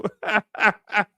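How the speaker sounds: amused burst of chuckles; tickled, rhythmic laughter; real laugh. Real, not acted. Amused by Sparky's answer.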